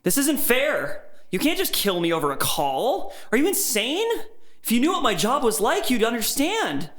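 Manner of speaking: high, desperate protest; panicked pleading. A narrator's reading. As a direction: plead for your life in rising panic. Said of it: "my own sobbing and wheezing".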